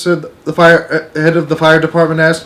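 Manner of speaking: ordinary delivery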